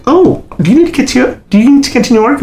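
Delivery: high, helpful airline lady; gate agent voice. Retold or imitated. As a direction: chirp it up like a friendly gate agent. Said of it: "the lady at the end was like".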